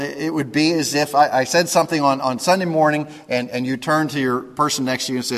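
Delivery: normal talking voice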